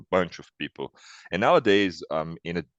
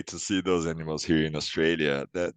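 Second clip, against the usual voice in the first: ordinary talk, nothing marked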